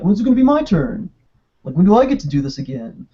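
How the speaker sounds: lightly voiced whiny Leatherface, high at the start; a hint of Napoleon Dynamite in a mock complaint. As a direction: Leatherface griping like a sidelined dork. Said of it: "turned Bubba Sawyer into Napoleon Dynamite".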